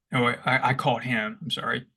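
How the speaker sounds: normal talking voice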